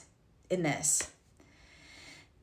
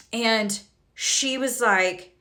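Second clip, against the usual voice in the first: ordinary delivery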